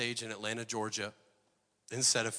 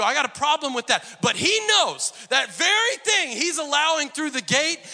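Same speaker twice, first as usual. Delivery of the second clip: loud, high, driving sermon delivery; preaching at full force; 10 semitones higher, 9 dB louder than usual. Acted. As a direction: preach it hard to the congregation.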